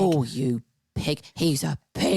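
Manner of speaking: low, drawn-out Cockney jibe; Cockney accent. Acted. Insulting someone as back in the day, low Cockney. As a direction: sneer it in slow mock Cockney. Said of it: "Cockney accent".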